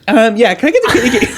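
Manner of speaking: high, laughing mock coffee order; giddy order impression. Friend mimicking a coffee order, high and giddy. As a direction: squeak the order, barely holding in laughter. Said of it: "it was just like".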